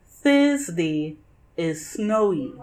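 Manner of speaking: ordinary delivery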